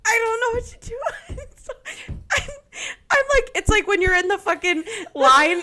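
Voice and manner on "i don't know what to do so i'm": high, wheezing laugh that almost turns to crying; helpless laughter. Real, not acted. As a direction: laugh until you can barely talk. Helpless laughter recalling the moment.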